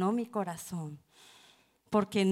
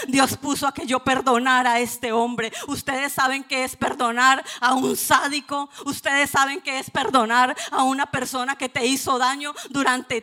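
loud, higher impassioned preaching; preaching at full force; 4 semitones higher, 9 dB louder than usual. Real, not acted. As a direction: cry it out to set the church free. Predica con fuerza. (predicando a toda voz)

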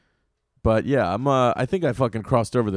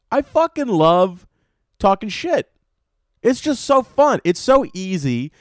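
gleeful outburst; 7 semitones higher, 5 dB louder, slower than usual. Real, not acted. Loud gleeful confession about shit talking.

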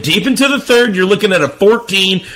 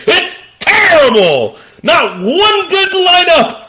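excited shouting; 8 semitones higher than usual. Real, not acted. Host hyping viewers on a terrible slate, shouting. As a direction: sell the trash week at full volume.

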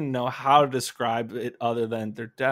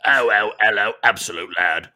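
posh lad voice; 5 semitones lower, 7 dB louder than usual. Acted.

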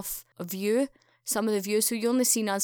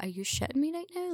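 shocked disbelief; 7 semitones higher, 4 dB quieter than usual. Retold or imitated. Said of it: "I was shocked".

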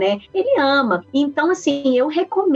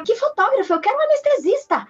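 exasperated demand; 12 semitones higher than usual. Retold or imitated. Retelling demands during labour.

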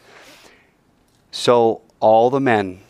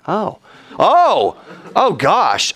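rising, louder wince; hammed-up alarm; mock shock; 9 semitones higher, 3 dB louder, much slower than usual. Shechem hearing about circumcision, hammed-up shocked wince.

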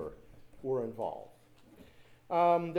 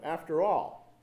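ordinary delivery

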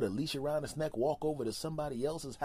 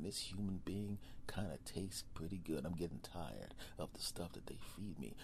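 low, soft, conspiratorial predator voice; lion quietly plotting a bite; scheming lion; 7 semitones lower, 9 dB quieter than usual. Acted. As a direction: mutter like a beast plotting its owner's end. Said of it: "is gonna start saying".

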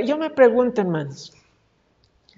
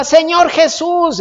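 loud, high, drawn-out cry; shouting even harder; 8 semitones higher, 6 dB louder, slower than usual. Acted. Blind man refusing to be silenced calls to Jesus, shouting hard. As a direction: shout the name to be heard. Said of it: "más fuerte gritaba".

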